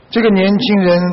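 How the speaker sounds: normal talking voice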